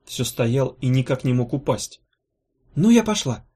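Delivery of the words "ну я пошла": high, hurried-sounding farewell; abrupt, raised-pitch leave-taking; suddenly remembering. A narrator's reading. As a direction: catch yourself and announce you're off. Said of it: "спохватилась Гелата".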